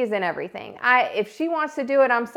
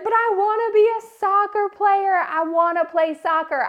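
put-on higher pleading little-girl voice; imitating crying child; 10 semitones higher than usual. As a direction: plead like a tearful kid. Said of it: "And she starts crying".

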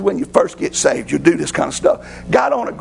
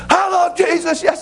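sudden high call over the booth divider; Daniel jumping up to shout at the lady; Daniel's outburst; 10 semitones higher than usual. Retold or imitated. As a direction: pop up and holler it at the next booth.

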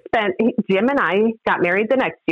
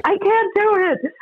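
mock despair; slower than usual. Burned-out wedding photographer twitching.

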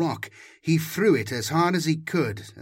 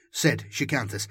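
usual speaking voice

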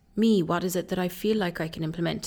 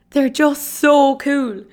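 gushing; 7 semitones higher, 8 dB louder, much slower than usual. Real, not acted. Gushing about dance group.

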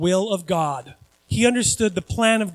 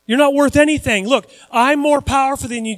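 raised, scornful boasting; mocking taunt; 6 semitones higher, 5 dB louder than usual. Guard to beaten Jesus, loud scornful taunt. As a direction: belittle him like a bullying guard.

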